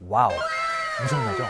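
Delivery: high excited exclamation; wide-eyed, delighted shout; amazed wow. Real, not acted. Amazed at five thousand converts.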